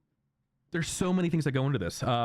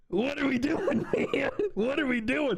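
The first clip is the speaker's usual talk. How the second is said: laughing high rant. Real, not acted.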